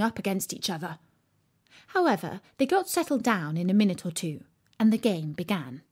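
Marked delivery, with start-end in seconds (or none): none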